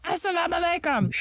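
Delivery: imitating a screamed "Assalamu alaikum"; mock screamed greeting. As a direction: scream "Assalamu alaikum" at the man who ran into your car. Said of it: "You're, like, screaming it at him".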